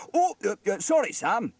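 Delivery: high, loud stammered apology; flustered apology. A narrator's reading. Trevor apologises to Sam, flustered. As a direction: falter guiltily into sorry.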